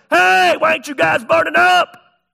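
high, loud shouted question; calling out. Acted. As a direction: holler it into the furnace in disbelief. King calling into furnace.

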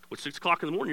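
normal talking voice

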